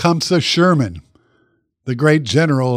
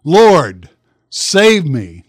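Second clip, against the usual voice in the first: loud, emphatic plea with weight on "Lord"; imitating a drowning man's desperate call; retold cry for help; 7 dB louder, much slower than usual. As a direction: cry out "Lord" loudly, then "save me". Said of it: "he cried out".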